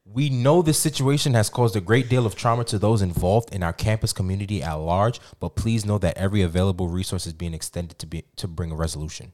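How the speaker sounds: formal, low statement reading; official statement read. Acted. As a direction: read it like a press release.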